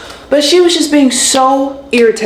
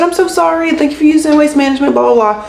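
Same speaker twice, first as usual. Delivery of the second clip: high-pitched phony apology; imitating a rote phone agent; mock customer-service sign-off; 5 semitones higher than usual. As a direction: recite the polite script in a raised voice.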